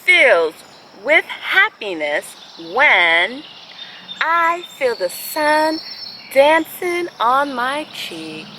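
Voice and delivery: slow, gentle storybook reading; picture-book read-aloud with long pauses; reading to children. Acted.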